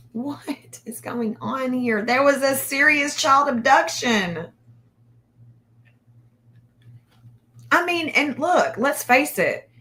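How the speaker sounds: can't believe what she's reading; exasperated disbelief. Real, not acted. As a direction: let the outrage push the voice up. Outraged about delayed Amber Alert.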